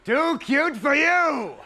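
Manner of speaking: slow, high, loud hammed-up host call; mock Ed McMahon hyping the kids; showbiz announcer. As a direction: drag out each word like a cheesy TV host. Imitating the show host.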